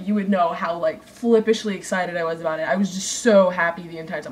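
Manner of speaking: ordinary talk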